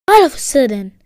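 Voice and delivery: ordinary delivery, nothing marked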